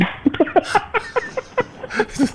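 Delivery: wheezing laugh; high breathy laugh. Real, not acted. Laughing at own impression.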